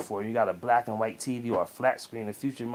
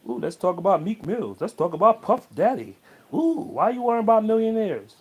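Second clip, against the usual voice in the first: higher and louder, a hammed-up gossip voice climbing to a squeal; mock gossip lover; 9 semitones higher, 6 dB louder than usual. Mocking viewers who want gossip over advice, in a hammed-up squeal. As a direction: ham up an eager gossip who only wants celebrity talk. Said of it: "What would you rather hear?".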